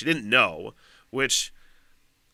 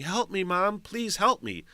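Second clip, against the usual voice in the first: mild higher-pitched imitation of a pleading daughter; retold bawling; 8 semitones higher, slower than usual. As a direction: plead higher, only lightly imitating the crying. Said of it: "and bawling".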